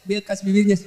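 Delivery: ordinary delivery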